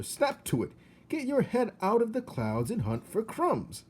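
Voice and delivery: stern father. Acted. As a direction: order him about. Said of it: "barked Despero's father".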